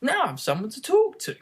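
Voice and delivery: a lightly voiced Alice pleased to find a friend; delighted young girl. A narrator's reading. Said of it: "she said to herself".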